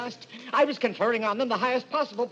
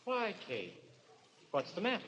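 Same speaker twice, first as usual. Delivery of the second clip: eerie softness; 11 dB quieter than usual. Acted. Killer feigns innocence.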